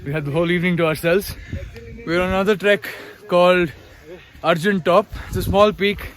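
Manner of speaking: talking while winded from running; catching breath between words. Real, not acted. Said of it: "We've been running".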